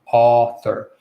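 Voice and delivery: author with the jaw dropped on the first vowel; careful, lengthened author; tongue-back AW. Shown as a demonstration. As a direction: hold the open aw in author. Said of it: "Move your tongue back".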